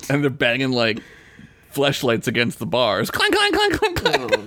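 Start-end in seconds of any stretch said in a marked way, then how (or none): 3.1-4.5 very high clanking imitation; clanking noise. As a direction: squeak out the clank sounds. Imitating fleshlights against bars.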